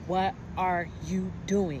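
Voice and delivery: talking down to her, drawn out; condescending repeat. Retold or imitated. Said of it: "a very condescending, disrespectful tone".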